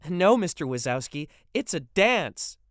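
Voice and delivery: put-on a girl cheekily correcting her friend's father; cheeky teen girl. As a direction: draw it out to needle him. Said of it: "another voice announced".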